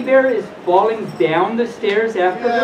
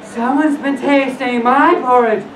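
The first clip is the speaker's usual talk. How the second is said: slow, slightly raised mother bear; higher, drawn-out storybook mama bear; middle-sized mother bear voice; 5 semitones higher, slower than usual. Acted. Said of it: "in her middle-sized voice".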